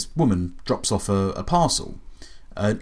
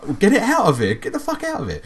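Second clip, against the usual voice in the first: higher-pitched imitation of indignation; mock angry outburst; 10 semitones higher than usual. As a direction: act out an indignant dealer throwing someone out. Acted quote of angry car dealer.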